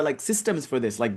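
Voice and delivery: ordinary talk, nothing marked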